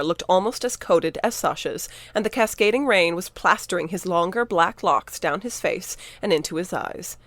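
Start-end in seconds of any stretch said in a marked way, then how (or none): none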